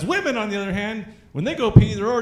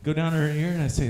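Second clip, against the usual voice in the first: ordinary delivery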